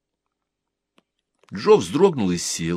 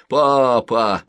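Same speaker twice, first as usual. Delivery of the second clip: louder teasing imitation; mocking mimic; 5 semitones higher, 7 dB louder than usual. A narrator's reading. Older boy mocks Joe's sleepy word, teasing. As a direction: mimic him with a snicker. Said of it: "со смешком передразнил".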